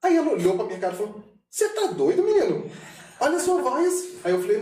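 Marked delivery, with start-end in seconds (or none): 1.5-4.1 much higher, incredulous scolding; Ju's disbelief. Retold or imitated. Impersonating Ju scolding the narrator about his voice, incredulous and high. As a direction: squeal it like you can't believe him.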